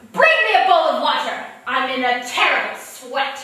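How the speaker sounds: loud bellowed stage entrance line; stage yell. Retold or imitated. Bursting onstage as the queen, a loud bellowed entrance. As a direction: bellow it to the back row. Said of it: "I yelled".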